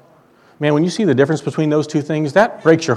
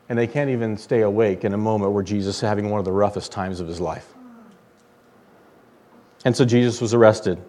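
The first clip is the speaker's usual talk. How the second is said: ordinary talk, nothing marked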